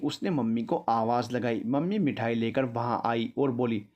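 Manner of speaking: ordinary delivery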